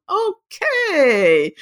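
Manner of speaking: drawn-out, high-pitched hesitant okay; dubious okay. Retold or imitated. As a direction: stretch it out high, full of doubt. Doubtful reply to risky gallery offer.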